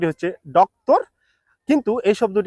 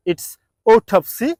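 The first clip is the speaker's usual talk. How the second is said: autopsy drawn out slowly, AW-tap-see; slow careful repeat; 3 semitones higher, much slower than usual. Shown as a demonstration. Teacher repeating autopsy slowly for learners. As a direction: stretch each syllable for the learners. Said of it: "I can repeat one more time".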